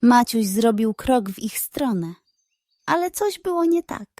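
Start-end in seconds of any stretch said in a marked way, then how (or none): none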